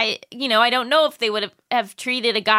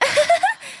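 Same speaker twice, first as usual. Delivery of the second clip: high, awkward nervous giggle; nervous laugh. Shown as a demonstration. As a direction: demo your nervous habit laugh. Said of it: "I have such a nervous laugh".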